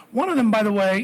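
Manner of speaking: ordinary delivery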